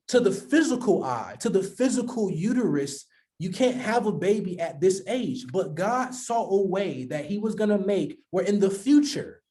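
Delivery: ordinary talk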